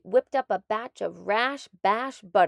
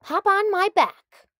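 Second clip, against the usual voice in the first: higher, louder eager offer; snake's call; 11 semitones higher, 8 dB louder than usual. A narrator's reading. Snake offering the doctor a ride, eager cry. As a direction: call him up onto your back. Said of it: "Jake cried".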